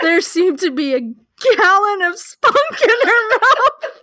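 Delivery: loud, high laughing read. Real, not acted. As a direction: read it while cracking up. Cracking up reading erotica.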